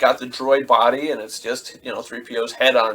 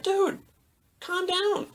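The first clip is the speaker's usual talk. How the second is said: squeaky, halting incredulity; mock calming, pitched way up; high-voiced disbelief; 14 semitones higher than usual. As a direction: react in a high, baffled voice. His reply to the screaming Anakin.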